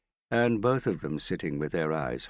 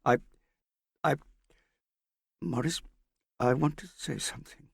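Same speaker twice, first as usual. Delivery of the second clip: hesitant; 11 semitones higher than usual. A narrator's reading. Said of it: "Her voice trailed into a whisper".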